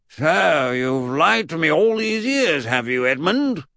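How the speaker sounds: loud, slow, high-pitched reproach; stern accusation. Acted. Great-uncle accuses Edmund of lying, stern and loud. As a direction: stretch it out, sternly indignant. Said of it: "said his great-uncle sternly".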